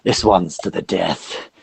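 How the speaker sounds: a lightly voiced orc ringleader; hint of a gruff fight announcer; slow, lightly voiced orc hyping up a brawl. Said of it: "the sort of main orc".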